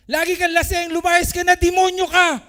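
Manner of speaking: very loud, high furious yelling; retold neighbor's yell. Neighbor wife screaming at her drunk husband, furious loud yell. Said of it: "Bin po, aga-aga".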